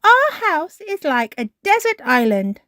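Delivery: put-on high, loud, delighted child voice; amused exclamation. A narrator's reading. Peppa looks at all the water, high and amused. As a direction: lift it high with amusement, no actual laugh. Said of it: "Peppa laughed".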